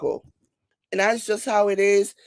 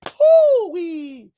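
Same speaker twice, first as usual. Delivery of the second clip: loud high-pitched whoop, falling away; drawn-out falling "hoo-wee"; 8 semitones higher, 6 dB louder than usual. Real, not acted. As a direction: whoop in amused disbelief. Exclaims at the fakeness.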